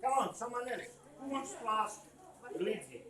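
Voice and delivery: ordinary delivery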